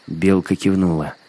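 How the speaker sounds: ordinary delivery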